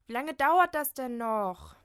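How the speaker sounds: loud, slow, impatient call through the door. Acted. Ungeduldiges Rufen an der Tür. (ungeduldig laut rufen)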